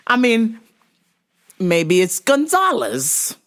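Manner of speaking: ordinary talk, nothing marked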